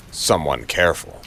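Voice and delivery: ordinary delivery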